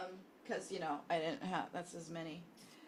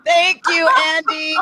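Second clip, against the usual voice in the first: high laughing shout; 11 semitones higher, 11 dB louder, much slower than usual. Real, not acted. Celebrating the win, laughing.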